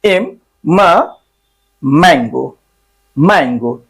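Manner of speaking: म drawn out slowly, then मैंगो; phonic sound demo. Shown as a demonstration. Teacher sounds out म for students. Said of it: "एम पढ़ा जाता है".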